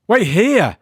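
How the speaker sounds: high, loud urgent command; urgent shout. Acted. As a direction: bark the order as you run off. John urgently orders Kirsty.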